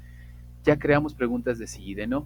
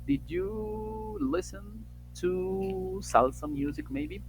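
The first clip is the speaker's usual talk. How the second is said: very slow, high, drawn-out English question; reading a student's question; 9 semitones higher, much slower than usual. Retold or imitated. Teacher reading out student Oscar's question, drawn out. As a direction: read Oscar's answer aloud slowly. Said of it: "Dice su compañero Oscar".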